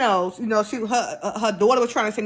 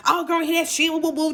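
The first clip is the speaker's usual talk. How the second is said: mock cussing out; 6 semitones higher than usual. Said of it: "just kinda cussing me out".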